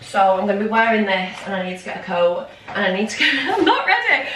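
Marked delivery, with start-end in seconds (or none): 3.3-4.3 laughing through a high, flustered line. Real, not acted. Running late for Manchester, flustered laughing. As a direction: giggle it out, caught unprepared.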